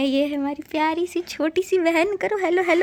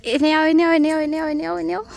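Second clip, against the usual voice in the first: higher, louder sing-song call to the calves; calling animals; 4 semitones higher, 4 dB louder, much faster than usual. Real, not acted. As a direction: call them over, bright and repeated. (बछड़ों को ऊँची आवाज़ में बुलाना)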